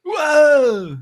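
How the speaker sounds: high, loud, drawn-out whoa; one big falling catchphrase exclamation; badly faked sitcom catchphrase; bad Joey Lawrence. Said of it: "that whoa was terrible".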